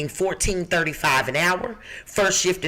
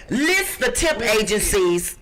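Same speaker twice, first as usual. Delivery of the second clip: ordinary delivery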